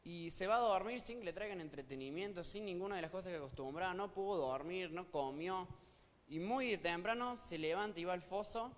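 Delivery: usual speaking voice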